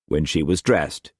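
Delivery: ordinary delivery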